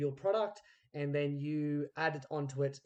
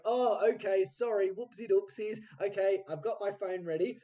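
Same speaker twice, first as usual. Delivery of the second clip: sheepish apology; 6 semitones higher, 4 dB louder than usual. Retold or imitated. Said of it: "It's like".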